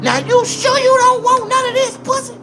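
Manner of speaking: high, loud teasing woman's voice; exaggerated high sultry lady; 1 mock seductive woman. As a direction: tempt him, hammed-up. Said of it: "talking about".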